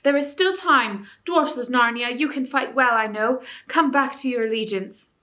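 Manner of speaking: rally the dwarfs back to their loyalty; urgent call. A narrator's reading. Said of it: "cried Tyrion".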